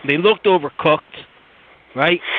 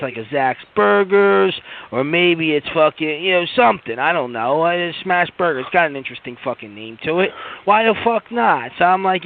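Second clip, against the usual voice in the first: normal talking voice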